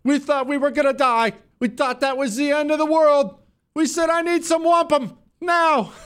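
squeaky, hammed-up weeping voice; mock emotional. Host ridiculing a clip of an arrested woman, mock crying. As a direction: parody her tearful account in a very high wail. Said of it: "Sorry, I'm getting emotional".

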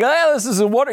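high-pitched gushing game show host; Caesar Flickerman. Retold or imitated. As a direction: gush like a TV host on air. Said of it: "like the game show host".

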